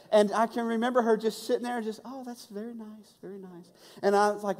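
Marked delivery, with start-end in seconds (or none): 2.0-3.7 much softer, unimpressed murmur; mild grandma reply. Retold or imitated. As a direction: damp the excitement, barely interested.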